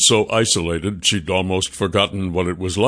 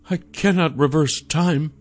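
sighing, higher-pitched resignation; 8 semitones higher than usual. A narrator's reading. Sasha admits her limits to the woman, sighing. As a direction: sigh first, then admit it helplessly. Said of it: "with a sigh".

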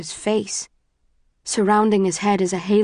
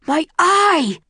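high, loud agonised scream; 11 semitones higher, 5 dB louder than usual. A narrator's reading. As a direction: scream out in pain, pitch soaring. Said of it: "he screams".

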